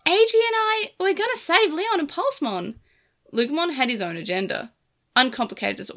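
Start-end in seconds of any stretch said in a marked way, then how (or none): 0.1-2.8 high, indignant little creature; bristling small monster, defiant; feisty Digimon voice. A narrator's reading. Lugumon voiced by narrator.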